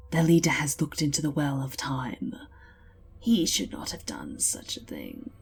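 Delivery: ancient being whisper. Acted. Ancient Slan speaks gravely.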